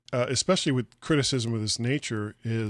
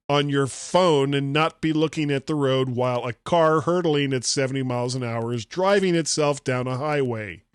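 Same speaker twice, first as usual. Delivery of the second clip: ordinary talk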